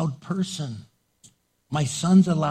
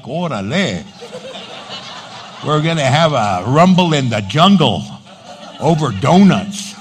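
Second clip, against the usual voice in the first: mock street-tough dare, louder; tough-guy challenge; 6 dB louder than usual. Preacher playing himself ready to fight over donuts, mock tough. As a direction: square up jokingly over donuts. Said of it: "And I'm like".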